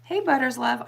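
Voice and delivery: ordinary talk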